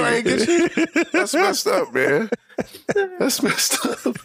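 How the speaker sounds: high, laughing voice, breaking through the words. Real, not acted. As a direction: laugh your way through the line. Laughing while retelling friend's reaction.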